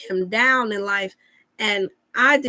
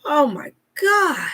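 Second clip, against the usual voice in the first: high, slow, drawn-out imitation of the client; his way of talking; 6 semitones higher, slower than usual. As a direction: stretch it out like the grateful man. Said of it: "this how he talk".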